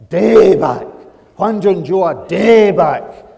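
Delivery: Daebak shouted high and very loud; slow, full-force shout landing hard on daebak; emphatic correct delivery. Shown as a demonstration. Preacher showing off the Korean word as taught, emphatic shout of daebak. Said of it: "when I'm being taught this word".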